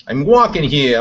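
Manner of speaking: loud New York cabbie shout; New York accent. Acted. Loud New York shout at a passing driver. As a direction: yell it like a New Yorker in traffic. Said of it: "New York accent".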